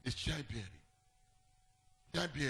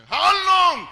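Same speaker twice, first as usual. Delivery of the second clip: loud challenging cry; preaching shout; 11 semitones higher, 17 dB louder than usual. Acted. Pastor challenging the congregation, loud shout. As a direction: hurl the question at them. Preacher answers the doubter loudly.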